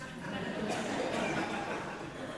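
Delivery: breathy little laugh at himself; soft laugh. Real, not acted. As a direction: laugh it off softly. Laughs at own accent.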